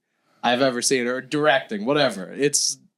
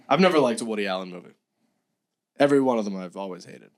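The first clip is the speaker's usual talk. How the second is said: usual speaking voice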